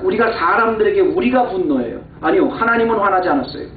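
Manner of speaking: ordinary talk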